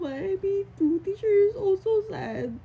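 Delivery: loud, high and tearful; sobbing out her own reply; crying. Real, not acted. Crying while retelling reply. (humahagulgol na sagot)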